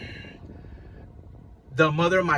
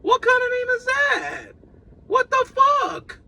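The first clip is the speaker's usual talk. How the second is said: much higher, shouted disbelief; incredulous shout. Retold or imitated. Father hearing the baby's name, high shouted disbelief. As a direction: yell it like the name is absurd. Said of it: "And I'm like".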